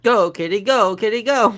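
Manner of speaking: high, loud chanted urging; root for him to run; cheering the cat. Real, not acted. Owner urging her cat to go, high and cheering.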